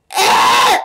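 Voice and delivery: loud high yelp; frustrated yelp. Real, not acted. Gamer reacting to a fall in the game, loud frustrated yelp. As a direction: bark out a frustrated cry.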